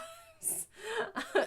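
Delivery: a burst of high laughter. Real, not acted. Mom laughing about socks for gloves.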